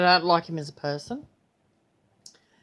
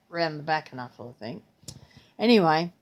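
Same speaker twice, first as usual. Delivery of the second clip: usual speaking voice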